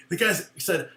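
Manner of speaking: ordinary talk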